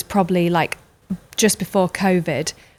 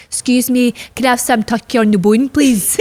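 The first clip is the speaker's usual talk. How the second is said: put-on light Scottish accent, raised and polite; 5 semitones higher than usual. Daughter imitating her Scottish mum at the deli counter in a light Scottish accent. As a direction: ask politely in a Scottish lilt. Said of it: "So my mum's Scottish".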